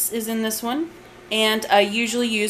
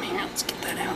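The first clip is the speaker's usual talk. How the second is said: whispered aside; 9 dB quieter than usual. Real, not acted. Aside while working.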